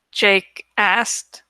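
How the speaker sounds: ordinary delivery, nothing marked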